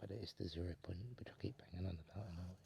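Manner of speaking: usual speaking voice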